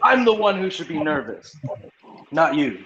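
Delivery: normal talking voice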